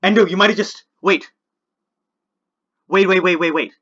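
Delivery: loud urgent rising waits; frantic hold on; tense excitement. Real, not acted. Tense battle moment, repeated waits.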